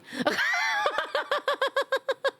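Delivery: shrieking laugh. Real, not acted. Streamer cracking up at an awkward joke, shrieking laugh. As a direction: let a big laugh out.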